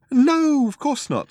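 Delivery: breezy. A narrator's reading. Zaphod denies visiting Earth, breezily. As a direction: wave it away lightly. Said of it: "said Zaphod breezily".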